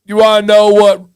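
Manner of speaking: badly imitated surfer-turtle dude accent; TikTok dude's accent. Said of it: "I'm butchering his, his accent".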